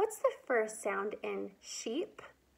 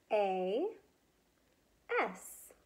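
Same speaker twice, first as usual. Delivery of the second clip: normal talking voice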